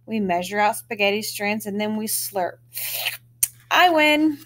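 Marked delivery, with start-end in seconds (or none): 3.7-4.4 triumphant. Acted. Triumphant win at slurping contest.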